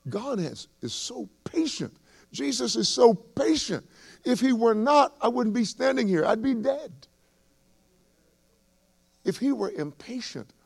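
high, ringing, loud preacher's cry; impassioned pulpit climax; full-force preaching. Acted. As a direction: lift the congregation with rising fervour.

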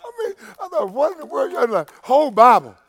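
breaking up mid-story, pitch climbing high; real laughing. Real, not acted. Preacher cracking up at his own drilling-rig story, laughing. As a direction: try to get the words out through laughter.

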